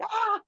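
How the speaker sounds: high-pitched yelp of being hit; short imitation cry of pain; mock pained cry. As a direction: cry out high, as if shot in the back. Imitating Magneto being shot.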